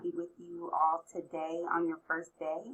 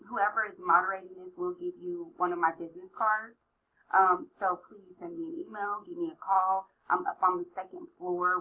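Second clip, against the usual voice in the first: ordinary talk, nothing marked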